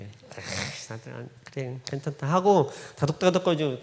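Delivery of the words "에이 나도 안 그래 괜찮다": very soft, low consoling murmur; gently pat the child with the voice; soothing. Retold or imitated. Father comforting his repentant son, soft and soothing. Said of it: "갑자기 다독다독거려요".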